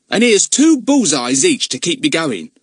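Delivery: cheeky lad telling the twins to keep watch; Ern, bossy boy. A narrator's reading. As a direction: boss the little sisters about. Ern hands out sweets.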